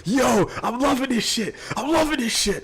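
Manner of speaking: can't contain the excitement; excited. Real, not acted. Thrilled by the vampire idea.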